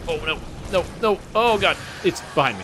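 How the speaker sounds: frantic as something closes in; being chased. Real, not acted. Monster chasing him in game.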